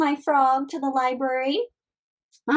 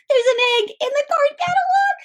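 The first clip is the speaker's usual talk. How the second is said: surprised; 12 semitones higher, 3 dB louder than usual. Acted. Librarian reacts to egg.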